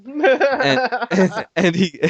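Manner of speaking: loud laugh bursting through the words; laughing loudly. Real, not acted. Storyteller laughs loudly at his own tale to friends. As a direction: crack up mid-story, too loud. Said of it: "I'm getting loud".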